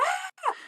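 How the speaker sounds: a sudden high panicked shriek; panicked scream. Retold or imitated. Porn pops up on her screen at work, panicked shriek. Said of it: "I was like".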